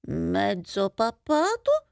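slow, pondering question with a rising end; musing aloud. Acted. Mouse mulls over the second odd name, musing aloud. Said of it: "rimuginò il topo a voce alta".

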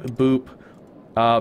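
ordinary delivery

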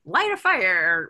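imitating a parent's hype; pumping up. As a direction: talk a kid up with retold enthusiasm. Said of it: "trying to pump up the kids".

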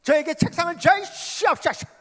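high, loud pleading cry; crying out to God for a desk; fervent prayer. Shown as a demonstration. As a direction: beg God with everything you have. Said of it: "그렇게 기도하는데".

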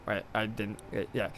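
ordinary delivery

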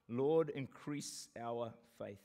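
slow, reverent reading of the verse; slow solemn prayer. Shown as a demonstration. Preacher reading the verse slowly to the church. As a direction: dwell on each word like a prayer. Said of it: "I want to change that to".

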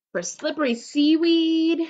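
storybook read-aloud; reading to children. Acted. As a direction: read it slowly to a little child. Reads picture book aloud.

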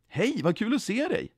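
high, swooping happy greeting; glad. A narrator's reading. Jesus blir glad igen, happy greeting. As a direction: greet with open delight. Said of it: "och blev glad igen".

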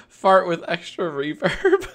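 reading it slowly while cracking up; can't get the words out without laughing. Real, not acted. Reading chat comment, laughing through it.

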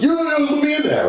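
very high, excited squeal; high-pitched hammed-up eagerness; overeager chick kid. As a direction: kid jumping in to help.

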